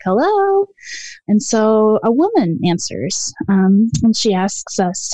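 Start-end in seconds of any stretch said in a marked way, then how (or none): 0.0-0.7 much higher, sing-song call. Retold or imitated. Girls calling to footsteps in the leaves, high call. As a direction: call out across the creek. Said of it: "we were like".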